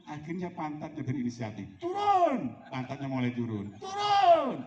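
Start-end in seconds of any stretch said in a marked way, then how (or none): 1.8-2.5 high, drawn-out command call; perintah lantang. Acted. Acting out an unclear team command. 3.8-4.6 high, drawn-out command call; perintah lantang. Acted. Acting out an unclear team command.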